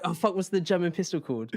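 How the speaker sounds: normal talking voice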